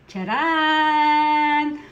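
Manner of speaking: a big proud announcing call; loud catchphrase. Acted. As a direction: belt out the greeting. Shouted catchphrase with cup. (malakas na pagbati)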